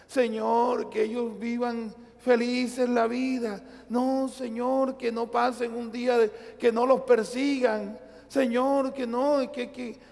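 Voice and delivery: high, pleading mock prayer; hammed-up anxious prayer for the missionaries; whiny worried prayer. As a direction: imitate a fretful believer begging God. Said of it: "pidiéndole a Dios".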